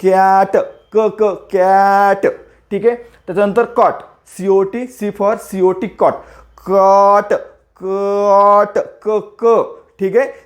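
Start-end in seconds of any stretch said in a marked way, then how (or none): 0.0-2.3 slow कॅट, hard k at the front; sounding out hard C. Shown as a demonstration. Teacher answering how C sounds, कॅट drawn out. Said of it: "कसं pronunciation काय होतो सीचा?". 6.7-9.6 long open vowel in कॉट, then short hard क, क; stretched phonics demo. Shown as a demonstration. Teacher drilling hard C, slow stretched कॉट. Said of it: "सीचं pronunciation काय आहे?".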